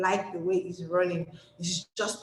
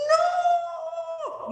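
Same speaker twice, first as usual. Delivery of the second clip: very high, long shouted NO; full-voiced practice shout. Shown as a demonstration. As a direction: belt out a long, high-pitched no. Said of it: "how would you shout?".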